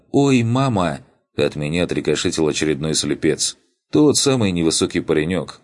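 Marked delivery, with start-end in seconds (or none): 0.1-1.0 high pained cry of surprise; painful yelp. Acted. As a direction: yelp it out as if it hurts. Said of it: "С болезненным".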